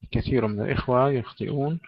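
usual speaking voice

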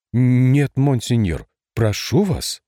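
urgent, anxious protest; alarmed plea. A narrator's reading. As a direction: rush in worried, pleading. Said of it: "встревожился Сенешаль". (встревоженно, умоляюще)

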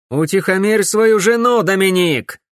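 loud, high-pitched cry of exasperation; angry shout. A narrator's reading. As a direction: shout it as an irritated demand. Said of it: "кричала Корделия". (раздражённый крик)

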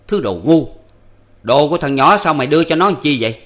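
high, loud, harsh berating of his wife; angry scolding. A narrator's reading. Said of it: "nạt vợ rằng".

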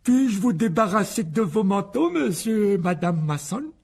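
high, loud, drawn-out call ringing through the hall; slow, high put-on politeness, projected loudly; Dudley. A narrator's reading. Dudley greeting the Masons in the entrance hall, loud high role voice. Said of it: "la voix de Dudley retentit".

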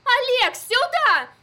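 high, frantic shout into the radio; hysterical shouting. A narrator's reading. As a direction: scream for help, desperate. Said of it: "вдруг истерично закричала". (истеричный крик)

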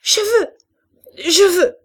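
very high, loud repeated demand; child's tantrum at breaking point; hoarse, torn screaming. A narrator's reading. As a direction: scream it as if choking with rage. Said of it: "d'une voix de plus en plus rauque et déchirée".

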